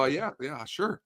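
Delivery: soft, noncommittal server reply; small, wary acted "sure"; hesitant compliance. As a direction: agree quietly and reluctantly.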